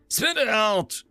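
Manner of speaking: high, loud impatient bark. Acted. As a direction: snap at her to hurry. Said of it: "he said impatiently".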